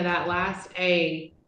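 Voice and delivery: ordinary delivery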